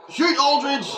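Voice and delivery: high, loud command; real excited yell; urgent shout. Real, not acted. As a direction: scream at the player to shoot. Yelling at player to shoot.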